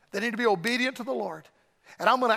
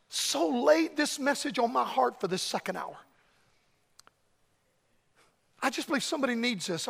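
pleading preacher altar call; 2 semitones lower than usual. Acted. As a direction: call them forward gently.

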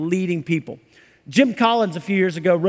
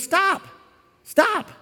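high, urgent call to stop; Jesus raising his voice over the crowd; 11 semitones higher than usual. Retold or imitated. Jesus calls out to the disciples, high urgent stop.

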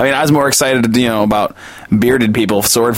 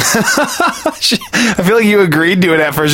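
usual speaking voice

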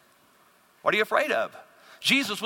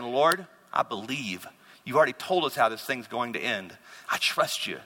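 calm prayer of faith; 6 semitones lower, 3 dB quieter than usual. Acted. Believer trusting God in the storm, calm low prayer. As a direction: lower the voice and pray with quiet trust. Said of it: "Instead of saying".

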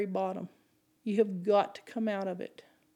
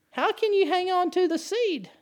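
the same question thrown out much higher and with force; fervent repeat; 9 semitones higher, 4 dB louder than usual. Acted. Preacher pressing his congregation with the question again, fervent. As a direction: hit it again, pitched up and urgent.

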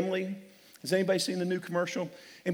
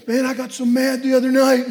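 angry husband; 7 semitones higher, 9 dB louder than usual. Retold or imitated. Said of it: "he goes".

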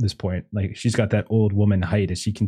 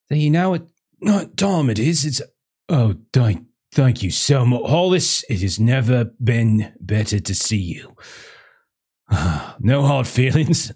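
higher-pitched, sleep-deprived man at the door; bleary, woken householder; 3 semitones higher than usual. Acted. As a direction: greet them as someone hauled out of bed. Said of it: "he's just saying".

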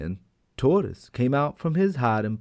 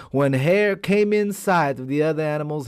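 usual speaking voice

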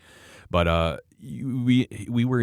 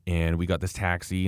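normal talking voice

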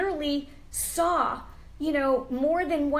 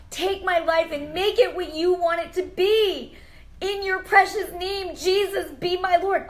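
higher and louder, near tears, begging Jesus; pleading prayer; 6 semitones higher, 6 dB louder than usual. Shown as a demonstration. Host models a viewer's prayer, tearful loud pleading. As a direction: cry out to God like you mean it, voice breaking. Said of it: "Cry out and say".

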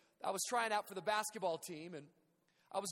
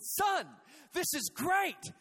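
higher, louder overjoyed dad; dad shouting with delight from a hole; cheerful dad shouting; 9 semitones higher, 6 dB louder, much slower than usual. Retold or imitated. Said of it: "He said".

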